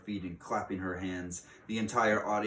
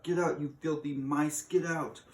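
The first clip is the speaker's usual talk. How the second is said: higher mock shout; teachers shouting; 8 semitones higher, 3 dB louder than usual. Teachers on desks shout at mice, higher mock shout. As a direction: shoo them off like an outraged teacher. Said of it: "stand up on their desks and shout".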